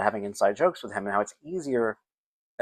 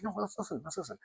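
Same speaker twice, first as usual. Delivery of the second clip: gentle coaxing; 6 semitones higher, 15 dB quieter than usual. Retold or imitated. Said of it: "I'm like".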